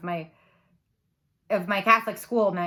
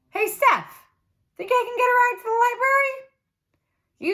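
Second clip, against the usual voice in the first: put-on much higher, louder casual boy's voice; voiced boy Arthur; 13 semitones higher, 4 dB louder than usual. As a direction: ask for a ride like an easygoing kid. Arthur's voice in the story.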